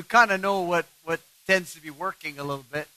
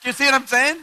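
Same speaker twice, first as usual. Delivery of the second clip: high, louder teasing question; high playful; 9 semitones higher, 5 dB louder than usual. Real, not acted. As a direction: toss it up high with a grin. Joking, laughing question.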